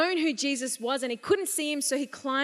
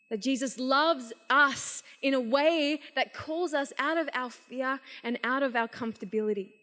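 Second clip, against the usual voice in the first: ordinary delivery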